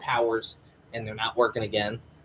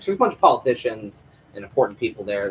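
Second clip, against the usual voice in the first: ordinary delivery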